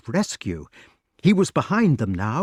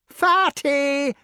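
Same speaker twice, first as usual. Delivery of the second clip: very high mocking cry; high-pitched shouted taunt; jeering shout; 4 dB louder than usual. A narrator's reading. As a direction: jeer it high like a crowd. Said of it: "shouted all the prisoners together".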